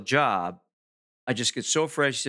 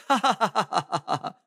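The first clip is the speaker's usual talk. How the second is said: mock laugh; 8 semitones higher than usual. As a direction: laugh it off with a put-on cackle. Mock laugh at mom's jab.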